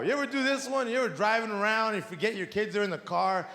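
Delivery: normal talking voice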